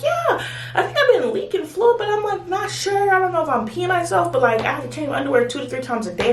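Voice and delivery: high-pitched retold hesitant answer; imitating yourself hedging in a high voice; unsure mock reply. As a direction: replay your unsure answer up high, easing down. Retelling her hesitant answer to nurse.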